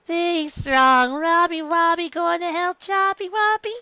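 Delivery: high, slow, dopey giggling; loopy under sedation, giggly and high; high sing-song drugged voice; drugged giggly Luffy. Acted. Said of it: "Luffy giggled".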